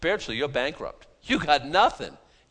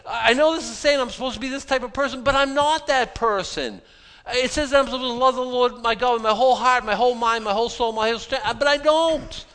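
much higher, louder, distressed self-accusation; anguished confession; 7 semitones higher, 3 dB louder than usual. Retold or imitated. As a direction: pour out guilt, slowing on the last words. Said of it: "They're like".

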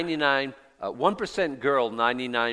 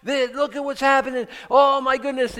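much higher and louder, mock fretful panic; 13 semitones higher, 9 dB louder than usual. Preacher mocking a news watcher getting worked up, higher, louder mock panic. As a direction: ham up a worried news-watcher losing it. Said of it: "you get all worked up".

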